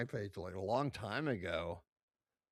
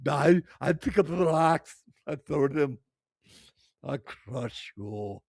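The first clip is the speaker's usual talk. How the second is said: booming mock Arnold Schwarzenegger voice in an Austrian accent, much louder and higher, slowing down on the last words; female Arnold; 5 semitones higher, 13 dB louder than usual. Streamer mocking the strongwoman as a female Arnold, loud hammed-up Austrian accent. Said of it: "sound like a fucking female Arnold".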